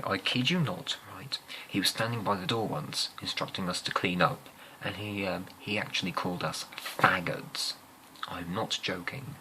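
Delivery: ordinary delivery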